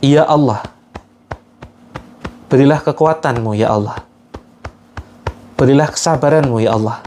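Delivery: pleading prayer intoned phrase by phrase; earnest guided prayer. Acted. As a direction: lead the group in begging God for strength to forgive. Leads a forgiveness prayer.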